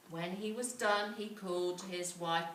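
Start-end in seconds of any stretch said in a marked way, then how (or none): none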